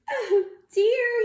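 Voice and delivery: high crying wail. Acted. Bird crying over the lost wife. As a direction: wail it in dismay. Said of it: "he cried".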